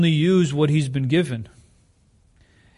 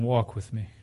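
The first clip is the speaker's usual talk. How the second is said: God's gentle call; 4 semitones lower, 9 dB quieter than usual. Retold or imitated. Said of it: "God is saying".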